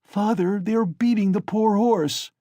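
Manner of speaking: put-on a boy begging his father; horrified child. A narrator's reading.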